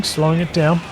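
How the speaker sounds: usual speaking voice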